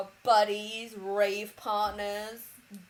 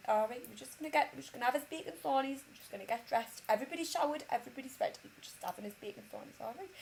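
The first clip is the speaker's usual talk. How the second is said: put-on rough Durham accent; 4 semitones higher, much faster than usual. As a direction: patter soothingly in attempted Durham. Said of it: "But I can't do a Durham accent".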